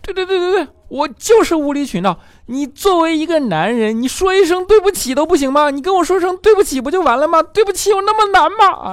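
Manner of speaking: put-on a girlfriend making a scene; dramatic girlfriend. Girlfriend demands an apology, very high loud hammed-up outburst. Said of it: "女朋友戏就来了".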